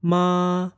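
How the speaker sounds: MA held high and level. Shown as a demonstration. Teacher demonstrates Chinese sounds, high level tone. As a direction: say MA high, steady and drawn out. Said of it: "if I use the Chinese sounds".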